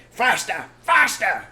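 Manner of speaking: very loud high urging; frantic loud shout. Acted. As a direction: drive the men on frantically.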